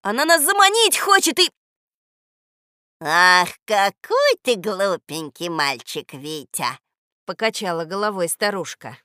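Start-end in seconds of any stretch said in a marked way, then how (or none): 0.0-1.5 very high, loud, urgent; alarmed shout. A narrator's reading. Boy warning friends of the witch's trap, urgent shout. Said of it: "закричал я". 3.0-6.8 slow, loud, drawn-out reproach; chiding old woman. A narrator's reading. Old woman dismissing the boy's warning, sweetly chiding.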